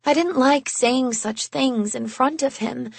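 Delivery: usual speaking voice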